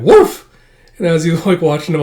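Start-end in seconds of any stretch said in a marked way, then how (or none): 0.0-0.5 sudden high, loud bark; dog bark. Acted. Bond answering Lloyd with a loud high bark. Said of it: "And he's like".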